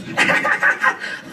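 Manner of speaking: high-pitched toy giggle; rapid squeaky doll laugh; Elmo doll giggle. Acted. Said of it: "you touch it, it goes".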